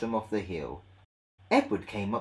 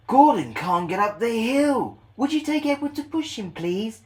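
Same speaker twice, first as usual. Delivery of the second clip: porter asking; 11 semitones higher, 6 dB louder than usual. Acted. Porter asks driver.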